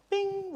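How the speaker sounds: high sung ping like a phone alert; bright two-note notification ping; message ping. Retold or imitated. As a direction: chime it like an incoming text.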